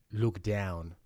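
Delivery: ordinary delivery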